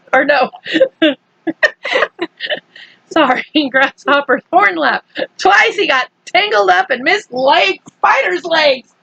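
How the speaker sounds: ordinary delivery, nothing marked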